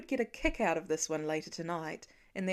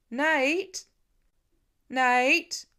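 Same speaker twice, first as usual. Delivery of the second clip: loud, higher worried calls; calling out; 4 semitones higher, 7 dB louder than usual. Acted. Father calling his missing son across the farm, louder and worried. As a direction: call into the dark, growing anxious.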